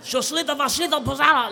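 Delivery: mock Russian gibberish. Old spa woman babbling at guests in mock Russian. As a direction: rattle off fake Russian fast. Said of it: "angry, old Russian woman".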